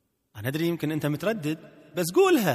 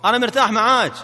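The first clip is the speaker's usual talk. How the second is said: raised pitch, strongly projected; loud, head-up delivery; 7 semitones higher, 9 dB louder than usual. Shown as a demonstration. Trainer drills husband to speak head up, loud and high. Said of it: "الآن تكلم، ارفع راسك فوق".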